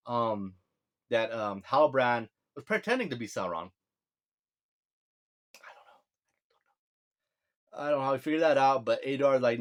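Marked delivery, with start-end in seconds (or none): 5.6-6.0 a voiceless whispered aside. Real, not acted. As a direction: drop to a breathy whisper, half to yourself. Muttered aside to himself.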